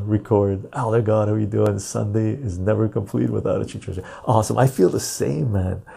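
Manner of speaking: ordinary talk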